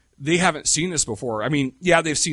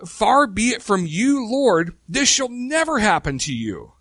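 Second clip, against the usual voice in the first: higher, slower, earnest protest; Peter protesting; 8 semitones higher than usual. Retold or imitated. Peter rebuking Jesus, high slow protest. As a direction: plead it up high like Peter pleading. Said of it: "he does it by saying".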